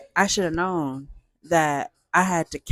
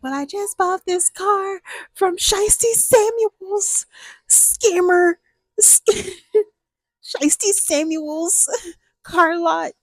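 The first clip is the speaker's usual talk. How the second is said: much higher, slow and wailing, laughing in between; flustered young driver; 12 semitones higher, slower than usual. Retold or imitated. Telling the neighbor who sold her the car, high laughing whine. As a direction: whine it out like a scammed kid. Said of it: "And I'm like".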